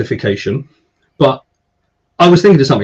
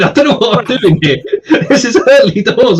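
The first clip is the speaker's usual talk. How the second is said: higher-pitched, laughing through the words; laughing protest; 7 semitones higher than usual. Real, not acted. Flustered host defends slow comment reading.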